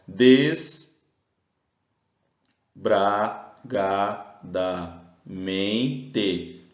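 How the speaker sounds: "desbragadamente" very slowly with pauses between syllables. Shown as a demonstration. Teacher separates the word on the board, "desbragadamente" in isolated syllables. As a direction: break "desbragadamente" into des, bra, ga, da, men, te.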